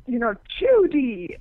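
gossipy shock. Retold or imitated. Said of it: "the other one says".

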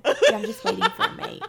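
high, loud, laughing through the line; laughing mock drunk excuse; laughing quote. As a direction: giggle out the drunk man's excuse. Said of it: "he's just sitting there".

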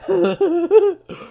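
high burst of laughter; real laugh. Real, not acted. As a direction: crack up. Laughs at own bit.

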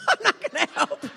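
high, loud laughing protest; laughing refusal. Retold or imitated. Guest speaker balks at catching a rabid squirrel, laughing refusal. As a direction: refuse through a burst of laughter. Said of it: "I thought".

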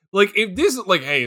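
normal talking voice

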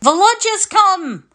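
high, ringing call across the yard; shouting. Acted. Servant in the yard announcing the boy, joyful shout. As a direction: shout the news of his arrival. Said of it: "someone shouted in the yard".

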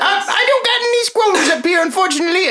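high, put-on gnome shopkeeper; squeaky-high gnome merchant.

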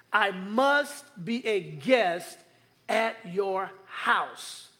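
very slow, softening to the end; slow solemn emphasis. Shown as a demonstration. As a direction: weigh each word.

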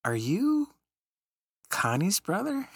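high, surprised question; surprised woman. Retold or imitated. Woman asking his brother if he knows Connie, surprised. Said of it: "And she said".

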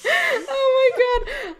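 slow, high, delighted cry; thrilled. Real, not acted. Excited about a favorite quote, squealing delight. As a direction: gush with glee.

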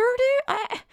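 incredulous exasperation; exasperated dude. Real, not acted. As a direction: pitch leaps way up on "dude?" in disbelief. Exasperated rant about vulgar writing.

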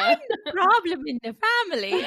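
high, exaggerated Irish accent; squeaked-up mock Irish impersonation. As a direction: push the comic Irish accent way up in pitch. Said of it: "doing an Irish accent for June".

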